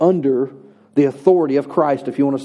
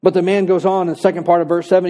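ordinary talk

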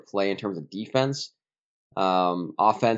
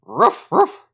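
mock dog bark, higher and louder; 8 semitones higher, 7 dB louder than usual. Host calls him dog pound.

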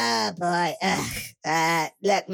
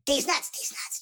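whispered sprite chant getting fainter; fading whisper; 7 semitones higher, 4 dB quieter than usual. Acted. Player voicing sprites as they fade away, whispering. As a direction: breathe it as they fly off.

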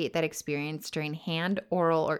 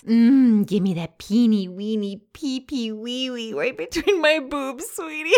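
put-on baby talk; 6 semitones higher, 6 dB louder than usual.

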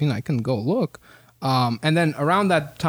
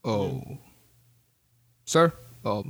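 ordinary talk

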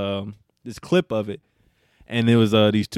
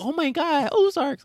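high, gushing mock excitement; mock hype fan; slower than usual. Friend mocking latecomers gushing about Ozark, high and excited. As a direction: squeal it like a late fan. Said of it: "motherfuckers in covid like".

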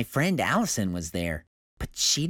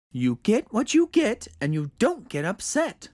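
mother's voice; 9 semitones higher than usual. A narrator's reading. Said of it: "she said".

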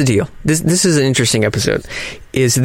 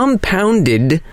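normal talking voice

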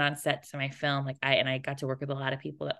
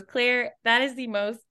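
1 imitating mom; 7 semitones higher than usual. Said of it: "My mom goes".

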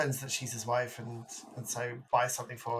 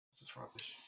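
whispered aside; 17 dB quieter than usual. Real, not acted. Muttered aside.

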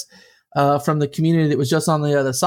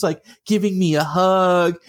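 ordinary talk

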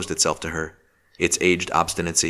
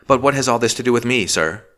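a mild sharp edge, higher pitched; 6 semitones higher, 5 dB louder than usual. A narrator's reading. Marjorie presses the magistrate, mildly sharp. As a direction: clip the question a little sharply. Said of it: "she asked sharply".

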